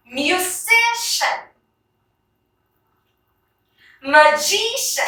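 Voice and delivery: Respiration, Modulation, Musician, Magician, each stressed on the next-to-last syllable; stress before final syllable. Shown as a demonstration. Teacher reads words for class, next-to-last syllable stressed in Respiration to Magician. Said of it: "Let's read the following words".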